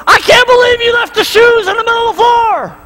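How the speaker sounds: high, exasperated complaint; mock angry nagging. Pretend parent coming home complaining, high and irritated. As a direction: nag about the shoes, hammed up. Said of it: "You come home and go".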